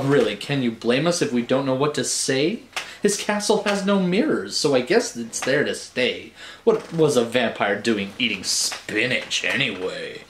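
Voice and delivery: ordinary delivery, nothing marked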